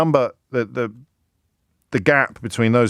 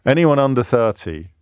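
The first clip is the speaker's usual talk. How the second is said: usual speaking voice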